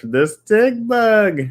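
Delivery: loud, high, slow "The stick bug"; excited recognition. Real, not acted. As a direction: shout it out slowly with glee.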